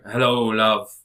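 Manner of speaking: loud put-on character accent; imitated Constantine accent. Co-host quoting the TV character's greeting, mock accent. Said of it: "And he's like".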